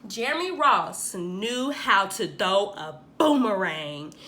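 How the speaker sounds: ordinary delivery, nothing marked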